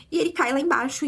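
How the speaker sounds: normal talking voice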